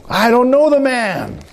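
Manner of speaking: high, drawn-out mock outburst; acted emphatic denial; cursing denial. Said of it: "he starts even with cursing".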